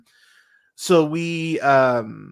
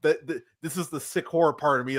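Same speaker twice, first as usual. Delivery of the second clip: usual speaking voice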